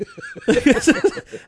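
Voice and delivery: high, loud burst of laughter; real laughter. Real, not acted. Storyteller cracks up at the friend's mom line, real laughter.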